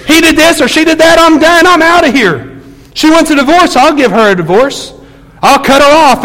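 mock the people who give up; mocking bitter quitters. Preacher imitates spouses ready to divorce, scornful. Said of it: "I hear it all the time".

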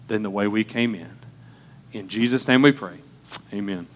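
hushed pastor's prayer. Acted. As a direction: pray it quietly and earnestly. Opening prayer before sermon.

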